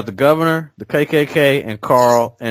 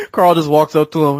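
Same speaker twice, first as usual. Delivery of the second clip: usual speaking voice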